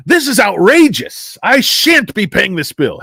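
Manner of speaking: high, loud, hammed-up indignant customer; mock outrage. Customer refusing to pay the bill, hammed-up indignation. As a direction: play haughty outrage, slow and high.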